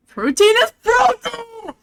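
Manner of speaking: high, loud, slow macho bellow; hammed-up gym bro. Player voicing the muscled hero gulping slime as a bellowing gym bro. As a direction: roar it like a proud meathead.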